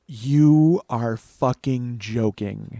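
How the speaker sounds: very slow, drawn-out disbelief; slow, deliberate incredulous reply; stunned disbelief. Retold or imitated. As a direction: stretch every word out in disbelief. Stunned by the parking price.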